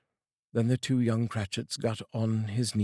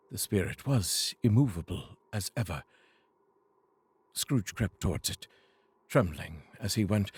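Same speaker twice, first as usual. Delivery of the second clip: ordinary delivery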